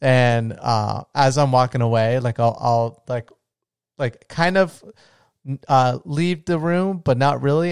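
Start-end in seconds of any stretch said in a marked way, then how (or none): none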